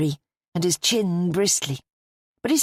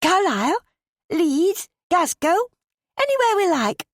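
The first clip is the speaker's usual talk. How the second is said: much higher and louder, playful list; giggly excitement; 12 semitones higher, 3 dB louder than usual. A narrator's reading. Bonnie teases Ben about their new car, giggly and excited. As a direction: toss out the cities with giddy glee. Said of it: "She giggled".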